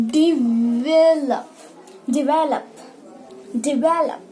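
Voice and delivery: develop slowly, di-VEL-up; correct pronunciation. Shown as a demonstration. Teacher repeating the right word for her class. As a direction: stress the middle of develop. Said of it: "डेवलप हमेशा गलत होता है".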